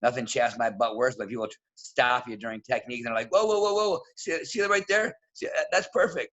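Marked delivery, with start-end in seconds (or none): 3.3-6.3 lightly voiced, high excited interrupting; mock meddling coach. Pushy coach interrupting a lesson, a hint of high excited whoa. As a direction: barge in with eager praise.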